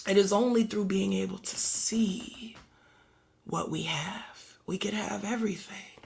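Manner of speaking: soft, hushed whisper; whispered preaching. Acted. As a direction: draw them close in a whisper.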